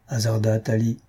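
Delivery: ordinary delivery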